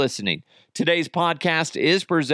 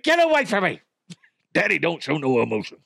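hammed-up shout, high, then a laughing fast brag; mock tough dad yell; 3 semitones higher, faster than usual. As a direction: play the macho dad pushing the kid off.

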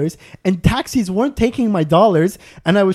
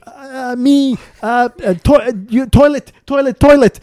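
higher, pleading toilet, toilet; pleading broken English; 6 semitones higher, 5 dB louder than usual. Retold or imitated. Traveller begging a bus worker for the toilet, higher pleading. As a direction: beg for the toilet in pidgin words.

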